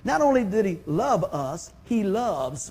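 usual speaking voice

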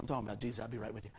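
low, soft aside over the shoulder; hushed aside. Retold or imitated. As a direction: fob off the person waiting, low and quiet. Quick aside to man waiting behind.

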